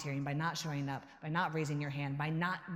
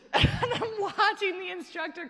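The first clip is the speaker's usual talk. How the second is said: laughing; 9 semitones higher, 4 dB louder, slower than usual. Real, not acted. Laughing while retelling.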